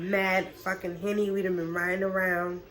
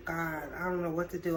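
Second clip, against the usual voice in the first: hushed scared; 3 semitones lower, 8 dB quieter than usual. Retold or imitated. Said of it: "I'm kinda scared".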